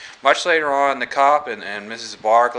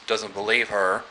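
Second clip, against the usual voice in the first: ordinary talk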